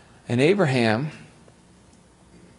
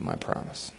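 hushed whisper; 14 dB quieter than usual. Retold or imitated. God's promise, dropped to whisper.

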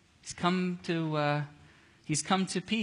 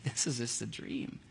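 dreamy murmur; 7 semitones lower, 8 dB quieter than usual. Acted. Peter thinks it a vision.

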